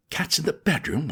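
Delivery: ordinary delivery, nothing marked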